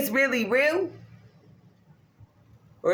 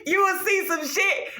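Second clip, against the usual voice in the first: laughing; 7 semitones higher than usual. Real, not acted. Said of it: "and I'm laughing right now".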